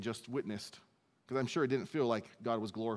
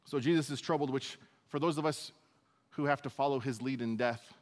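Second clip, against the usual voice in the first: usual speaking voice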